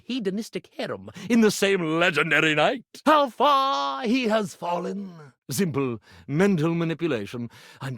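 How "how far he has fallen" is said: slow, higher theatrical cry of self-pity; dramatic lament. Acted. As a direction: wail it slowly to the rafters. Magician laments his own downfall.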